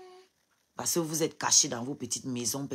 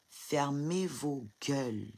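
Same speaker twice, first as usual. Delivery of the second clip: slow and soft, each word set down; quiet icy contempt; cold slow order; 5 dB quieter, much slower than usual. Real, not acted. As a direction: lay it down coldly and slowly. Telling commenters to shut up.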